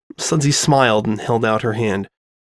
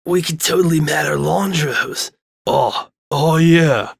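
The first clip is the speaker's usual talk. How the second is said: stoner drawl; 4 semitones higher, slower than usual. A narrator's reading. Stoners agree.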